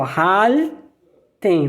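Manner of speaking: "halten" with the L curled on the tongue, drawn out; rolled German L. Shown as a demonstration. Teacher modelling German L for learners, rolled L. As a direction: say "halten" slowly, curling the L. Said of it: "você enrola a língua".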